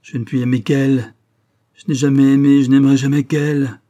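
building from plain to a furious shout; raging. A narrator's reading. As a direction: let the rage swell into a cry. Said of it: "avec l'accent de la rage la plus vive".

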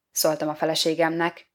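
usual speaking voice

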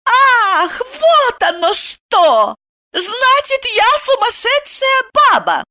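high-pitched outraged shriek; indignant wail. A narrator's reading. Woman confronting the man who insulted her, indignant wail. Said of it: "и завопила".

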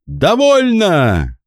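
high, slow, ringing command; ringing shout. Acted. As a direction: cut the fight short with a ringing cry. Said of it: "послышался звонкий голос". (звонкий крик)

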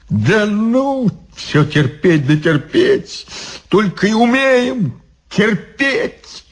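high, strained complaint; exasperated Ivan. Acted. Ivan protesting to the monks, exasperated. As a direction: protest the endless enduring.

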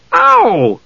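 high-pitched ow; yelp of pain. Acted. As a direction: cry out at a sudden pinch. Usha's shoulder is gripped.

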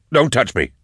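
high, loud sharp warning; angry recoil. A narrator's reading. Eisenhorn refuses the demon host's helping hand, angry recoil. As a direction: raise it sharply, push him away.

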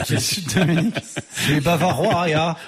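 put-on German accent. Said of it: "avec un accent allemand".

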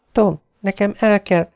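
high, hurried plea to her husband; urgent wife. Acted. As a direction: try to tell him you must go. Beverly's voice.

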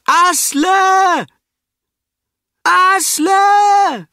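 a piercing, very high, full-volume shout; desperate shout. Acted. Brita screaming for the drowning Asle, desperate shout. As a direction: scream the name out over the fjord.

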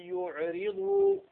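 آية يعرض with the tanween merged into the ya; slow high nasal glide into يعرض; merged ghunna. Shown as a demonstration. Teacher demonstrating idgham with tanween. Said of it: "مع التنوين".

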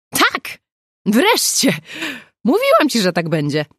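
high, loud triumphant cry; sudden shout. A narrator's reading. Agata reading the email and shouting with joy. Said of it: "i nagle krzyknęła".

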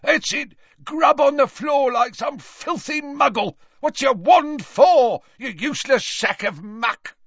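high-pitched angry shouting; furious berating. A narrator's reading. Father berating his clumsy daughter in a furious shout. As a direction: tear into her at high pitch.

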